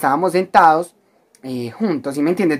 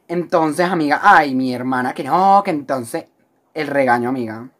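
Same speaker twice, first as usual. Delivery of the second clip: ordinary talk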